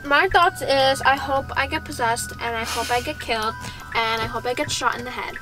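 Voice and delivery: ordinary talk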